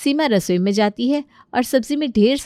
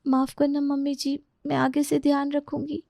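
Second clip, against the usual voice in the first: meek apology; 7 semitones higher, 5 dB quieter than usual. Acted. Daughter-in-law apologises after scolding.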